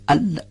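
ال cut off like a stop; stopped L. Shown as a demonstration. Teacher showing a wrong stopped lām. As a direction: block the air on ال.

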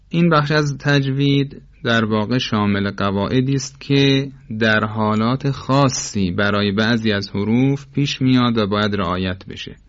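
ordinary talk, nothing marked

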